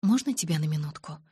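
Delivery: ordinary delivery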